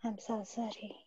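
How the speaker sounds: soft whispered repeat. Real, not acted. Client repeating the healer's words in a whisper.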